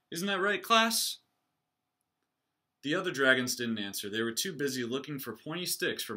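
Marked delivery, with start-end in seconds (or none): 0.1-1.2 high, loud question to the class; rally the class with a shout; coach calling out. Acted. Coach calling to his class at practice, loud.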